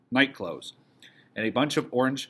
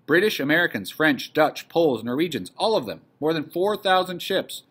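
loud, higher, emphatic listing; excited news; 6 semitones higher, 5 dB louder than usual. Acted. Meep telling the household who has landed, excited. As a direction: punch out each nation with excitement.

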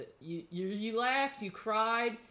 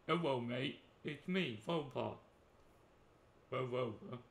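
put-on old speech synthesizer; 8 semitones lower, 4 dB quieter than usual. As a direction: lower robot voice, softer, breathy. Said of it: "Do the end of an inhale voice".

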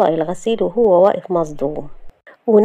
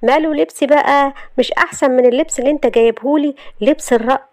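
higher, sassy heroine; defiant Shahd; 7 semitones higher than usual. Acted. Shahd defending her clothes to Youssef, sassy. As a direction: talk back with pitch lifted.

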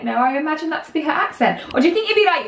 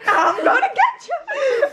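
menacing cartoon toe; 11 semitones higher, slower than usual. Voicing a drawn toe.

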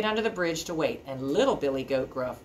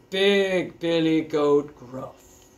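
slow, heavy big-goat voice; 4 dB louder, much slower than usual. A narrator's reading. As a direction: draw the name out big and slow. Introduces the biggest goat.

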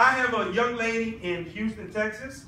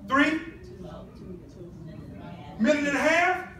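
laughing disbelief; 6 semitones higher than usual. Real, not acted. Audience guess seems too short.

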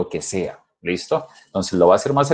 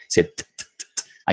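soft unvoiced t, t, t clicks; repeated t sound; 4 semitones lower, 4 dB quieter, slower than usual. Shown as a demonstration. Teacher isolating the t for students. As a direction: tap out the bare t sound. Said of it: "escuchen esa T".

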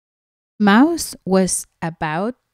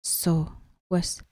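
whispering; 8 dB quieter than usual. Real, not acted. Aside whispered to listening child.

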